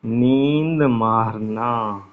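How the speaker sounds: नींद मारना drawn out very slowly while writing; slow dictation of नींद मारना; very slow, spelled-out नींद मारना; dictating slowly. Shown as a demonstration. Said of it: "लाओ लिख दूं".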